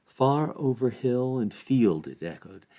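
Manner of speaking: ordinary delivery, nothing marked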